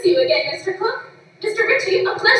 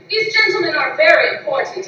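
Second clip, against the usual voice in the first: ordinary delivery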